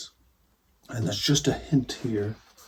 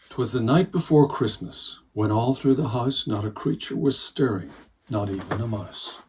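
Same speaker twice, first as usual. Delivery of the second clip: louder, fuller read-aloud start of the poem; storytelling reading; 5 dB louder than usual. Shown as a demonstration. Reader beginning the poem for children, storytelling voice. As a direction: open the poem like a bedtime storyteller. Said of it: "I'm gonna read the whole of the poem".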